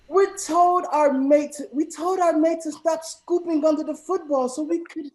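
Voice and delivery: loud, high put-on British accent. Shown as a demonstration. Host reading the football sentence to contestants in a loud, high British accent. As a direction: go big with a hammed-up British voice. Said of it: "read the sentence in a British accent".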